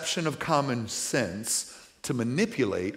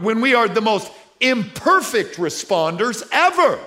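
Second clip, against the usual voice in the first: forceful preaching; 7 semitones higher, 6 dB louder than usual. Acted. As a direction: hammer the point home to the men in the pews.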